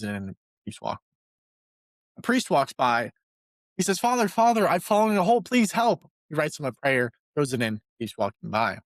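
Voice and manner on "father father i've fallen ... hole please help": cry for help. Retold or imitated. Stuck man calls to a passing priest, hammed-up pleading. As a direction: call up out of the hole, playfully desperate.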